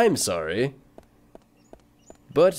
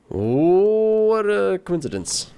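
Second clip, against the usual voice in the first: very drawn-out, higher-pitched mock amazement; mock surprise; 6 semitones higher, 3 dB louder, much slower than usual. Streamer reacts to the game's coincidence, mock amazed. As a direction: stretch it out in exaggerated wonder.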